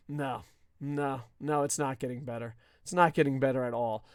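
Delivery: low, soft, resigned refusal; deflated denial. Real, not acted. Podcaster answering the optimistic fans, deflated. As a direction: drop down low and let the hope go.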